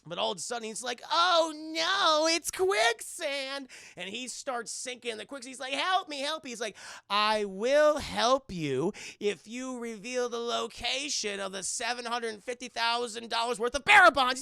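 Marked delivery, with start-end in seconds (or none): 1.1-3.7 high, loud, slow cartoon panic; panicked victim. Victim discovers quicksand, acted. 5.7-6.5 high, loud hammed-up pleading; mock cry for help. Sinking victim cries out. 7.1-14.4 taunting villain. Acted. Villain bargains with sinking man.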